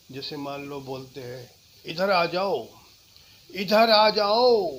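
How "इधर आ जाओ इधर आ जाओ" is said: rising to a slow loud call. Shown as a demonstration. Teacher demoing calling someone over loudly. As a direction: call them over from afar. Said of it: "कब जोर से, कब धीरे से".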